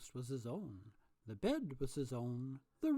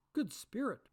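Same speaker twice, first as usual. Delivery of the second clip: raised desperate appeal; pleading; 8 semitones higher, 5 dB louder than usual. A narrator's reading. Scrooge falling before the spirit, imploring. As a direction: implore him. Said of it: "Scrooge pursued".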